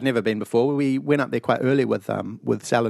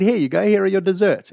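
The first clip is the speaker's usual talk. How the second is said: mock waiter; 7 semitones higher, 4 dB louder than usual. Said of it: "they said".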